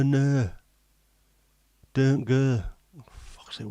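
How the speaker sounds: ordinary talk, nothing marked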